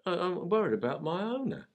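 put-on worried puppy in a high London accent. Puppy telling the wise Akita his worries, London accent. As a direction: play the fretful London pup. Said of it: "how would you describe Grasshopper's accent".

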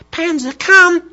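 very high, loud squeaked command; squeaky little girl. Retold or imitated. Little girl calling the dog, squeaky. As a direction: pipe it out like a small child. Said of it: "in a squeaky voice".

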